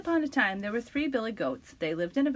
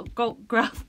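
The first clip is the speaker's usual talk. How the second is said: ordinary delivery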